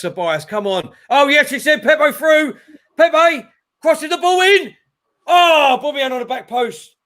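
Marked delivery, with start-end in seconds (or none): 1.1-7.0 much higher and louder, rising with the attack; calling the cross as it flies in; excited yell. Real, not acted. Attack builds, fan commentating live.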